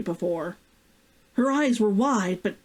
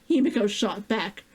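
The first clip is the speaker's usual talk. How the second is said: ordinary delivery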